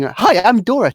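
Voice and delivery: high, loud eager greeting; excited, naive hello pitched well up; excited Dora. Acted. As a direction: chirp it to every passer-by, overkeen. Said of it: "Dora's getting really excited".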